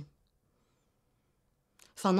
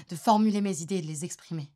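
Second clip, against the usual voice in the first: ordinary delivery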